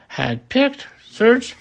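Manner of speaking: ordinary delivery, nothing marked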